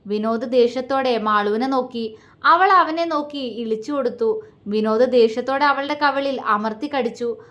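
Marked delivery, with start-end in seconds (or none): none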